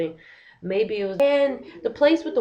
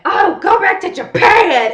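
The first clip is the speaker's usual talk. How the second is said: high, loud, slower furious yell; retold angry shout; 12 semitones higher, 12 dB louder than usual. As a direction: yell it at the staff in full fury. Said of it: "she was like".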